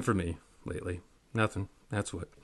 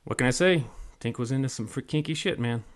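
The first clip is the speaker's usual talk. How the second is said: plain reading voice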